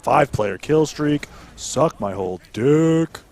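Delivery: slow, loud gamer brag; loud drawn-out kill-streak brag; hyped boast. Real, not acted. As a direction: announce it like a hype caster, drawn out.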